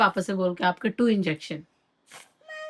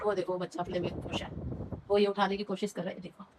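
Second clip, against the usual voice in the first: soft, fast, lowered voice; hushed aside; 5 semitones lower, 12 dB quieter, faster than usual. Real, not acted. Quietly pointing at the baby.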